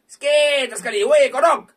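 loud, high call. Real, not acted. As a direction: shout for the frog to come out. Calling for frog symbols.

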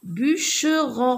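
"Bûcheron" split as bû-che-ron; syllable by syllable. Shown as a demonstration. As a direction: beat out three syllables in "Bûcheron". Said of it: "je frappe les syllabes".

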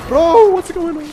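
loud, high, startled greeting to chat; excited. Real, not acted. Streamer hails his chat in startled excitement.

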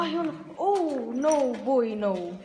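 normal talking voice

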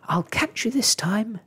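excited storybook shout. A narrator's reading. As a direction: call it out high as if bursting through a door. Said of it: "shouting as he threw open the door".

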